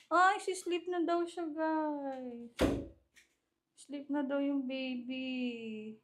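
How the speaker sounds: lifted, stretched-out mommy voice. Real, not acted. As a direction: coo over a sleepy little one. Baby talk over sleepy baby.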